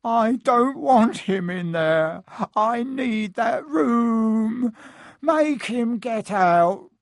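slow, high, tearful wailing; bawling child. Acted. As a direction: whine it out through sobs. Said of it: "Dudley bawling at his mother".

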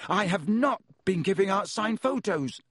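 Harry hotly denies giving out signed photos, heated; hot denial. A narrator's reading. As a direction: indignantly deny it, hitting not. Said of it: "he said hotly".

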